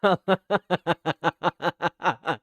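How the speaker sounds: sudden loud laughing burst; loud burst of laughter. Real, not acted.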